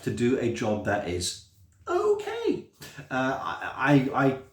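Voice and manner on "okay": very high, squeaky little voice; comically modest okay. Acted. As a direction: squeak it out as a tentative self-mocking verdict. Self-mocking verdict on own work.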